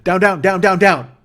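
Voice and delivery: loud, high repeated command yell; battle cry. Retold or imitated. Rider ordering the camel down, loud battle cry. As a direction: shout it over and over like a battle cry. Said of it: "been my fucking battle cry".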